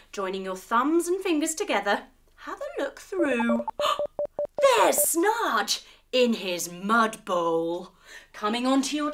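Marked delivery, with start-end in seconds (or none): 3.7-4.7 high startled gasp; quick high intake of breath; sharp gasp. Acted. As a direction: gasp as if you just saw the monster. Heroes spot the villain.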